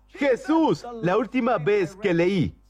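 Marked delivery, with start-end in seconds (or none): 0.1-0.8 very high single-word call; insistent retold cry. Beggar calling Jesus despite being told to hush, retold cry. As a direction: call the name out high, without real shouting. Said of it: "gritó aún más".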